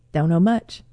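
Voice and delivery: low, offhand "Don't know much" with a lazy rise on "much"; mumbled reply. Shown as a demonstration. To a greeting, answer "Don't know much" low and casual. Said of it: "that is the standard".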